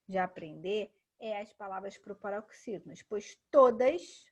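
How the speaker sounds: ordinary talk, nothing marked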